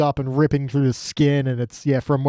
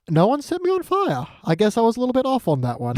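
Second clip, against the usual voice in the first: much higher, lightly voiced boy; higher sheepish shrug in the voice; mock sheepish kid; 8 semitones higher than usual. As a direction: admit he got one death wrong. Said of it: "And it's also like".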